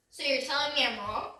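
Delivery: usual speaking voice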